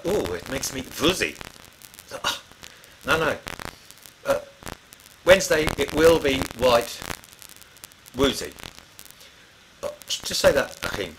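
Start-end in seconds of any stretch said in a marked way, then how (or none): 0.0-1.3 put-on light German accent on "woozy", first sound like a v. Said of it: "for a German it comes out". 5.2-8.5 slow, loud, stressing the w in "Wednesday" and "woozy"; coaching pronunciation. Shown as a demonstration. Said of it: "trying to coach him".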